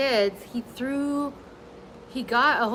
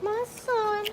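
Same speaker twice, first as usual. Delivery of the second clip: cooing pet talk; 11 semitones higher, 4 dB quieter than usual. Real, not acted. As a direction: croon to the dog. Cooing at her dog.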